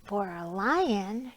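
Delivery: ordinary delivery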